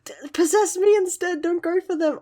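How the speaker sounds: mock-noble plea to take her instead; brave heroine plea. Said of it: "And she's like".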